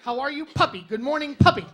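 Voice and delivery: loud insistent puppy talk; fixated repetition. Pretend. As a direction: chant 'puppy' like a man obsessed. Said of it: "you say".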